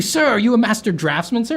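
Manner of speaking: high, loud, gushing waiter voice; fawning waiter. Retold or imitated. Waiter flattering the artist diner, gushing and fawning. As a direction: gush over him like an awed waiter. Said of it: "they'd be like".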